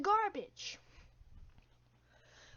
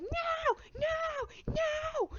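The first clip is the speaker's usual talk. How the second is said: very high whiny tantrum wail; tantrum kid; much slower than usual. Retold or imitated. Harold throwing a fit on the ground, high wail. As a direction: wail like a kid kicking the floor.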